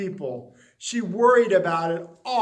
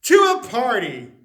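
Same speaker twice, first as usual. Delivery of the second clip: grand announcement; 3 semitones higher, 7 dB louder than usual. Acted. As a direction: announce it like a party invitation. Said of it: "it went on".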